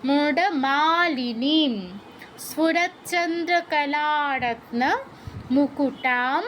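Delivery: the whole line chanted slowly; careful Sanskrit recitation to copy. Shown as a demonstration. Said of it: "এরকম".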